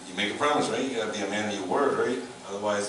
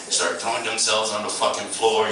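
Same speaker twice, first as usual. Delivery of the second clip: ordinary delivery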